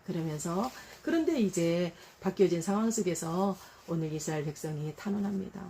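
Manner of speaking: ordinary delivery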